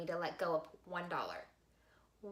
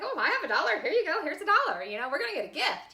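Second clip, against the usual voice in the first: high, loud eager chatter; excited eager; 10 semitones higher, 10 dB louder, much faster than usual. Retold or imitated. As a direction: gush over the dollar, high and loud. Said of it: "I'm like".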